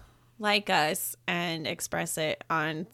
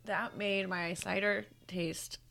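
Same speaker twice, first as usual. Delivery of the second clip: whispered; 7 dB quieter than usual. Real, not acted.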